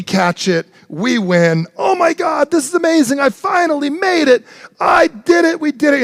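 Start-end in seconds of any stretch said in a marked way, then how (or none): 1.8-6.0 much higher and louder, yelling in victory; triumphant shout. Retold or imitated. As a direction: shout it like you just won the Super Bowl. Winning the Super Bowl catch.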